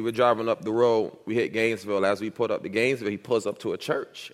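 ordinary talk

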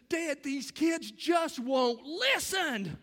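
high, slow, exasperated complaint; a grown son complaining to his dad, exasperated. Retold or imitated. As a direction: drag it out, fed up and pleading.

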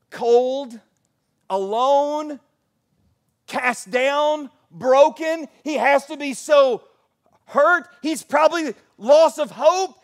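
high, loud, impassioned preacher's cry; full-force preaching. Real, not acted. Preacher voicing the church's worry for Paul, full-force preaching. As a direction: build to a shouted, urgent pulpit delivery.

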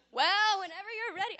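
loud, high retelling of his calm line; accidental yelling. Retold or imitated. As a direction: blurt it too loud. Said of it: "I'm yelling".

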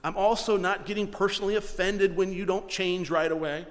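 ordinary delivery

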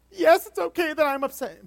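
put-on child's voice. Five-year-old girl answering her dad, high childish voice. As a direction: play the upset five-year-old, squeaky and loud.

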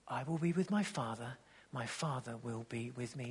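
almost breathe the promise; hushed reverent quote. Retold or imitated. Quoting Jesus, hushed and tender.

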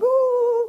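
very high, loud "ooh"; giddy squeal. Retold or imitated. Preacher shows how embarrassingly giddy you get, loud high squeal. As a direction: let out a hammed-up giddy whoop. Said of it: "it's embarrassing how giddy you get".

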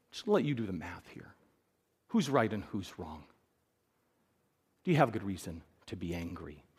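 low and soft, slowing down; quiet reasoning. Acted. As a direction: talk him down calmly. God reasons with Jonah.

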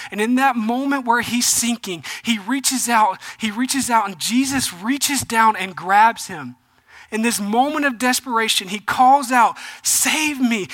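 loud, driving sermon delivery; preacher pushing the story to the congregation; preaching at full force. Acted. Pastor retelling Peter sinking, preaching full force.

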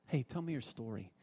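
hushed, friendly question; gentle invitation. Retold or imitated. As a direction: ask it softly like a friend leaning in. Voicing a friendly conversation opener.

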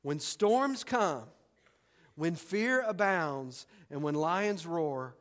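each phrase slow and deliberate; slow preacher build; slow, weighty repeat. Shown as a demonstration. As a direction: lay out every clause slowly. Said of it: "I'm gonna say that again".

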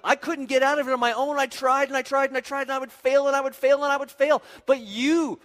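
loud, high, driving repetition; impassioned desperate testimony. Acted. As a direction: plead at full preaching intensity. Acted desperate testimony to God.

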